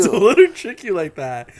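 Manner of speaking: laughing echo. Real, not acted.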